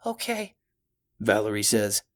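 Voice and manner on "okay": put-on scared little girl, much higher; small frightened child.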